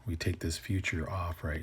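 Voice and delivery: usual speaking voice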